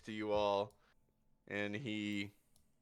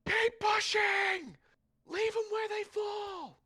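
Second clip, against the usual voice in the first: commander shouting; 4 dB louder than usual. Acted. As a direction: bellow orders over the battle. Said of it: "all you hear is".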